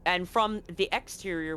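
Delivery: ordinary talk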